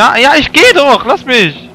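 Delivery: high, very loud protesting shout; exasperated yelling. Real, not acted. Player shouts at the attacker to be left alone, frantic yelling. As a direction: yell it while being chased off.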